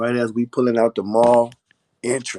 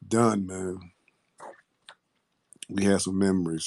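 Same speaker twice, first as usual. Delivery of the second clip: normal talking voice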